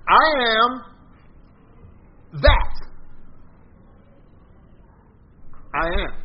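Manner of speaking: I am, then a loud high "that", then a long pause before "I am"; emphatic declaration. Shown as a demonstration. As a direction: hit "that" hard, let it hang before "I am". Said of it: "Did you hear what I just said?".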